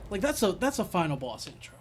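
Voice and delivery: hyped about the intro; impressed. Real, not acted.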